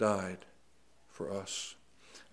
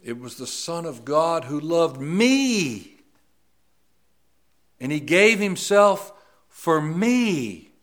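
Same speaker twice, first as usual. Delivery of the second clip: "me" drawn out and "gave" rising high and loud, slow; slow, swelling, lean into "me" and "gave"; stress on me and gave; 6 semitones higher, 10 dB louder, slower than usual. Shown as a demonstration. Preacher shows the emphasis that gets him most. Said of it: "is when I put the emphasis".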